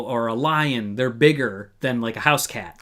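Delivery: normal talking voice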